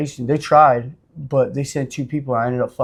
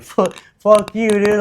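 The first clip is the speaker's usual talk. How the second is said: higher, louder mock yell; retold yell; 7 semitones higher, 5 dB louder, slower than usual. Jumping the guy in his cell, shouting fuck you. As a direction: yell it out like mid-fight. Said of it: "I was like".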